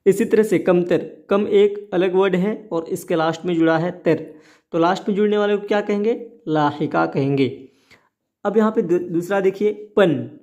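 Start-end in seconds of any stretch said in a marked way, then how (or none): 4.7-6.1 rattled off fast, a teacher's routine prompt; quick quiz question. Real, not acted. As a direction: fire the question off quickly to the class. Teacher quizzing students, rushed question. (तेज़ी से पूछा गया सवाल)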